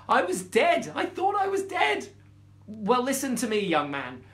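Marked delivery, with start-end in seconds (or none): 0.1-2.1 high, loud panicked squeak; frightened mouse. Acted. Mouse terrified recalling.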